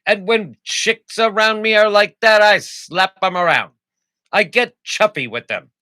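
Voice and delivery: high, loud put-on mid-Atlantic voice; mid-Atlantic accent. Reading definitions to co-host, hammy mid-Atlantic accent. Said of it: "in a mid-Atlantic accent".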